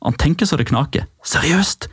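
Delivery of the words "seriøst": confused. Retold or imitated. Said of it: "spør han".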